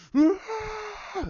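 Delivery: high, loud raging scream; strained yell of a fighter attacking; battle cry. Retold or imitated. Acting out enraged fighters clashing.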